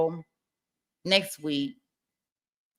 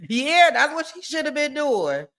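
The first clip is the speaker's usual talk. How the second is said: ordinary talk, nothing marked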